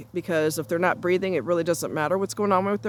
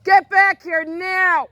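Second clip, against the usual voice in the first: loud, high, slow command; angry shout; 11 semitones higher, 8 dB louder, much slower than usual. Real, not acted. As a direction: order the dog back, drawn out and loud. Calling dog back.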